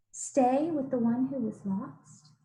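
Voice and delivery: put-on lively rhyming read-aloud for children; storybook reading. A narrator's reading. Teacher reading a picture book to the class, animated. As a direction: read the verses with bounce and warmth.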